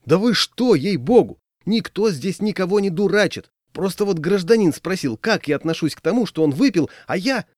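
high-pitched, flustered plea; imploring self-defence; pleading. A narrator's reading. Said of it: "взмолился я".

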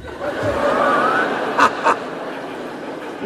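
quiet breathy laugh; laughter. Real, not acted. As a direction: laugh softly at the joke. Laughing after joke. (risa suave)

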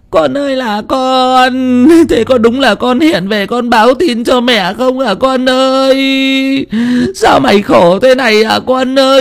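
slow, high keening cry; wailing lament. Acted. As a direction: wail for her dead child. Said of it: "kêu gào".

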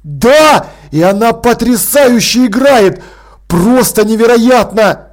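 loud, slowed, overjoyed exclaiming; excited raving. Acted. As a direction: gush with amazement. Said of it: "Ваймс просто с ума сходил".

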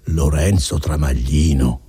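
low, slow repetition of a name; dazed echo. Acted. Renzo just woken echoes his own name, dazed. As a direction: drop low and say it slowly, half awake.

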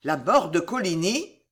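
ordinary delivery, nothing marked

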